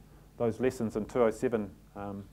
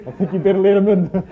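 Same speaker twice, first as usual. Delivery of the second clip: high, louder warning breaking into laughter; laughing through the words; 8 semitones higher, 12 dB louder than usual. Retold or imitated. Coach retelling the warning about the president, laughing. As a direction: laugh while you warn him. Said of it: "he goes".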